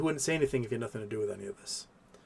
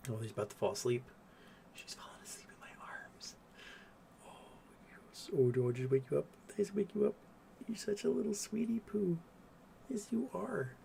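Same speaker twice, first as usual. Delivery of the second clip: whisper to sleepy cat; 5 semitones higher, 11 dB quieter than usual. Real, not acted. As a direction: coo quietly so the cat stays asleep.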